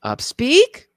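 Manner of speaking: UPSPEAK pitched high, rising like a question. Shown as a demonstration. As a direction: demonstrate uptalk with a sharp lift on UPSPEAK. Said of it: "when we go up at the end".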